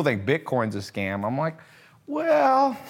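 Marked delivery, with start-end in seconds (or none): 2.1-2.8 high, drawn-out sarcastic well; mock the doubters with it; scoffing. Scoffing reply to critics.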